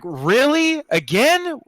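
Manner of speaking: high, loud incredulous questions; exasperated disbelief. Acted. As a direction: protest as if you can't believe it. Exasperated at friend attacking once more.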